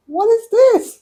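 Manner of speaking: high, loud exclaimed question; alarmed disbelief. Retold or imitated. Patient reliving her worry about conceiving, alarmed disbelief. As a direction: burst out in bewilderment, pitch leaping up. Acted alarm at fertility news.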